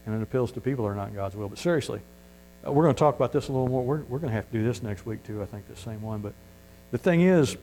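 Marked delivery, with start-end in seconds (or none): none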